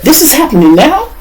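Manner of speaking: high, rising disbelieving lady voice; lady's shocked question. Retold or imitated. Lady hearing of the arrest, asking high in disbelief. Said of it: "This is what the lady said".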